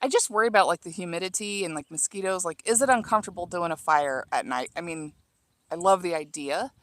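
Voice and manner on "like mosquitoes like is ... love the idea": ordinary talk, nothing marked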